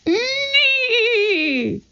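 very high drawn-out neigh; whinny like a horse in the night. A narrator's reading. A horse calling through the dark night, high whinny. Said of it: "A horse's whinny echoed".

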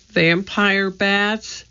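high, slow, drawn-out question; stretched-out, uneasy echo; faint dismay. A narrator's reading. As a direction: repeat it in slow disbelief, a touch of dread. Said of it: "He felt faint".

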